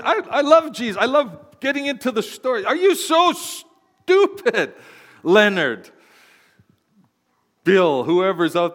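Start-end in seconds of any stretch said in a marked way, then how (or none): 2.6-5.9 high, slow, drawn-out mock scolding; mock exasperated rebuke. Pastor teasing a congregant by name, hammed-up high scold. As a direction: tease a churchgoer with a hammed-up scold.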